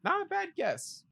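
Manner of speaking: soft, very high parroting; squeaky-high repeat; high-pitched echo. Retold or imitated. Echoes co-host in high voice.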